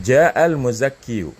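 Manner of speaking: ja al-muzakkiyu read slowly with the full yu ending; slow demo reading. Shown as a demonstration. Teacher showing the theoretical full reading, slow and deliberate. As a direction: spell out the yu at the end. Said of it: "bisa nggak dibaca ja al-muzakkiyu".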